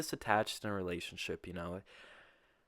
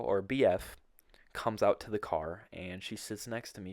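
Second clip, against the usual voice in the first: ordinary delivery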